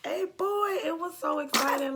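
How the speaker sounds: high, loud burst of joy; thrilled reveal memory; excited. Real, not acted. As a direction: squeal it with real excitement. Recalling the gender reveal.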